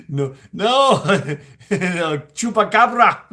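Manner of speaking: laughing through the words; giggling correction. Real, not acted. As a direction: correct it while cracking up.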